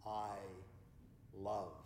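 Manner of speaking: low, soft God voice, word by word. Acted. God speaks to the whole world in closing, low and hushed. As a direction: speak as God, dying away with long pauses. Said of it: "God is speaking".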